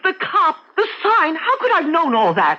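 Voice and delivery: alarm building as it comes true; frightened disbelief. Acted. Wife realising dream came true.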